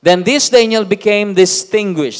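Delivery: loud, slow, solemn Bible reading; scripture reading. Acted. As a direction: proclaim the verses to the church. Reads scripture aloud to congregation. (malakas at mabagal na pagbasa ng Bibliya)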